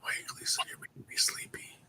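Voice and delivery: soft unvoiced whisper. Real, not acted. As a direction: keep it down to a whisper. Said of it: "Why are we whispering?".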